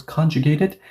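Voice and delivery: ordinary talk, nothing marked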